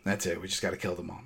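whispered, grim instruction; unvoiced mock-serious whisper; hushed order. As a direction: whisper it like a secret plan. Retelling Maggie's order.

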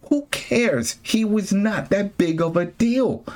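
high-pitched scoffing protest; exasperated dismissal. Real, not acted. Host dismissing a character being brought back, exasperated. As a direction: wave it away, voice shooting up.

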